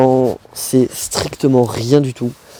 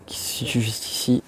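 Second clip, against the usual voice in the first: whispering; 10 dB quieter than usual. Real, not acted. Points out part on bike.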